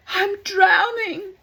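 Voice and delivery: high, slow crying plea; drowning man. Acted. Drowning man crying to the shore. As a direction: wail it out, going under.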